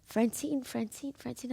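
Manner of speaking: soft, faint calling of a name; dying dad calling for his wife; dying father calling. Retold or imitated. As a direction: call her name weakly, fading. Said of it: "He's like".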